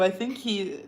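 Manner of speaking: ordinary talk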